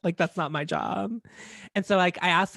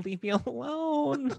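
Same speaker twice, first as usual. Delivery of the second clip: slow mock plea; 5 semitones higher, 6 dB quieter, much slower than usual.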